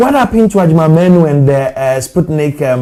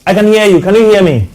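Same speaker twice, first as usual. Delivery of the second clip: raised voice over a bad line; to someone hard of hearing; 7 semitones higher, 7 dB louder, faster than usual. Real, not acted. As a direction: call out clearly to a faint caller. Caller barely audible on phone.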